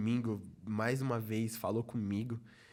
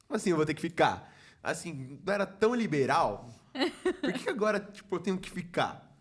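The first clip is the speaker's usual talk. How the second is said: indignant protest; 4 semitones higher, 4 dB louder than usual. Retold or imitated. Retold protest to the director.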